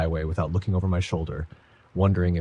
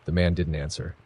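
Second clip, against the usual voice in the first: usual speaking voice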